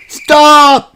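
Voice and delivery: high, loud shout; piglet's sharp high shout. Acted. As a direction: yell stop at the Queen. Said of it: "shouts Peppa".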